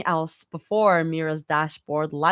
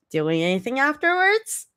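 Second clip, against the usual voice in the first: innocent; 8 semitones higher than usual. A narrator's reading. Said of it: "Zoe asks innocently".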